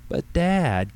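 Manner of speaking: high, pleading daughter; high-pitched teenage protest. A narrator's reading. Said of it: "Katie pleaded".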